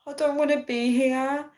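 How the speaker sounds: high, pleading retold voice; desperate. Woman begging her friend to rescue her, desperate. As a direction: imitate your own desperate phone call. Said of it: "my desperate voice on the phone".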